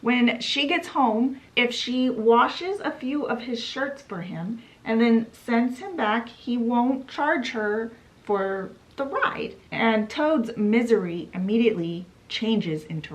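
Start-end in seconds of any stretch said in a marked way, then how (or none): none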